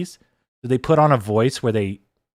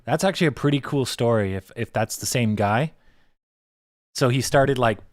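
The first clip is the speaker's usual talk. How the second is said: usual speaking voice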